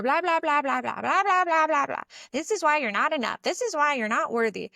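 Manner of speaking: high, chattering nagging voice; chattering inner saboteur. Acted. Inner saboteur berating her for not being worthy, high chatter. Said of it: "this chattering inner saboteur".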